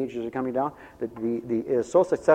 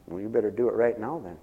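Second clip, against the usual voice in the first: usual speaking voice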